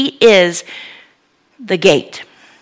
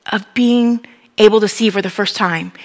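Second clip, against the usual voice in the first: ordinary talk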